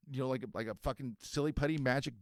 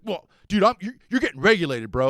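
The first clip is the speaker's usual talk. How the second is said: surfer bro; 5 semitones higher, 9 dB louder than usual. Retold or imitated. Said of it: "the Frankenstein face kid is like".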